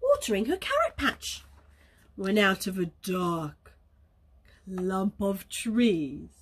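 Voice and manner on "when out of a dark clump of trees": low, slow storybook build-up; ominously slow and low; suspenseful narration. A narrator's reading. Suspense before the wolf appears.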